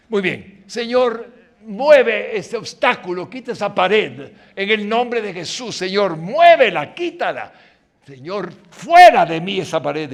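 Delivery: loud, insistent prayer shouting at God; demanding prayer. Acted. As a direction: command the Lord to move the wall.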